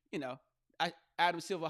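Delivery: normal talking voice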